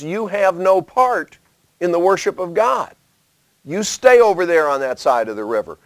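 lightly voiced future descendants waving others away; exclusionary voice. Preacher quoting descendants telling tribes to stay away, mildly mocking. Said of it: "saying to our descendants".

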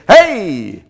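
loud, high "Hey!"; attention-seeking shout. Pretend. Preacher mimicking a show-off calling out loudly. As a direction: call out to be noticed.

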